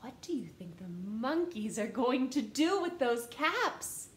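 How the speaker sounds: plain reading voice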